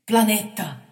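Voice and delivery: loud desperate call of a name; boy begging his leader; pleading. A narrator's reading. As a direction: plead with him. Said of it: "supplicò il ragazzo".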